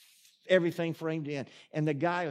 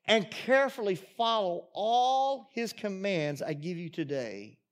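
raised, emphatic preaching voice; preached scripture reading; 5 semitones higher, 6 dB louder than usual. Acted. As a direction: read scripture out to the congregation, building in force. Reads scripture aloud to congregation.

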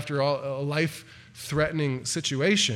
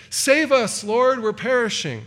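higher and louder, pleading for rescue; desperate cry for help; 7 semitones higher, 5 dB louder than usual. Acted. Disciples in the sinking boat crying to the Lord, desperate plea. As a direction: cry out as men about to drown. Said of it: "It's a cry for help".

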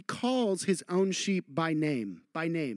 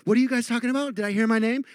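mock teenager call; 4 semitones higher, 4 dB louder, much faster than usual. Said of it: "one of them for sure will hear it".

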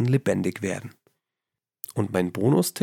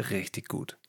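unvoiced whisper, much softer; 2 semitones lower, 11 dB quieter than usual. Real, not acted. Host whispering the New Year promise to listeners. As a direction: breathe the words out as a secret. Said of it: "podcast sign-off whisper".

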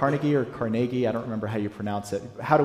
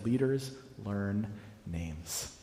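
slow quoted maxim; 8 dB quieter, much slower than usual. Retold or imitated. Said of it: "he said".

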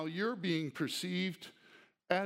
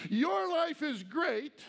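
sarcastic; 9 semitones higher, 3 dB louder, slower than usual. Acted. Mocking the Corinthians' self-view.

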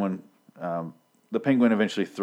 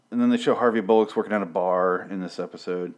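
normal talking voice

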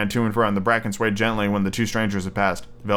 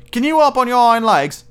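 high, louder storybook rabbit voice; challenging furry rabbit; 12 semitones higher, 5 dB louder than usual. A narrator's reading. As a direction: pipe up with a taunting question.

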